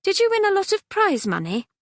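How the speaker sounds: little sister hoping for riches; hopeful. A narrator's reading. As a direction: ask it full of hope. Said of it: "said hopefully".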